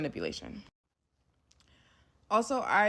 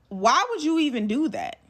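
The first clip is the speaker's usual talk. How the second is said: higher-pitched, exasperated question; appalled; 6 semitones higher, 4 dB louder than usual. Retold or imitated. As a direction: demand an explanation in disbelief. Retold confrontation, appalled question.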